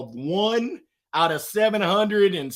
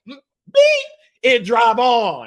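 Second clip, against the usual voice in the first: much higher, louder squeal of the bleeped word; cheeky kid shout; 6 semitones higher, 8 dB louder, slower than usual. Retold or imitated. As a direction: yell it like a gleeful teenage son. Son cheekily says the motto.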